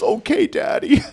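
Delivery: put-on very high, slow little-girl voice; little daughter. Dad imitates daughter agreeing to calm down, high child voice. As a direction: mimic a small child giving in. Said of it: "she goes".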